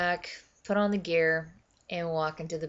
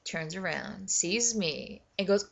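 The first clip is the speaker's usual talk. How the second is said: usual speaking voice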